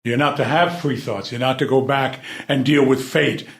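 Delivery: usual speaking voice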